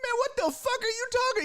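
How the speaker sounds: very high, fast squawk of disbelief; incredulous protest. Acted. Motorist challenging the driver's claim, incredulous and worked up. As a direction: burst out in exasperated disbelief. Acted quarrel with tow driver.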